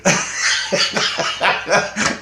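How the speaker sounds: high breathless laugh. Real, not acted. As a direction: burst out laughing. Laughing at alien's name.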